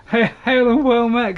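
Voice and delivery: high, loud cheery hail; delighted call to a newcomer; excited greeting. Real, not acted. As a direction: greet a friend warmly and loudly. Greeting a friend joining game.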